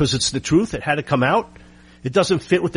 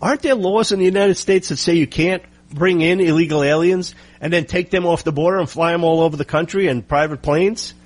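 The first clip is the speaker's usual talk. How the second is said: usual speaking voice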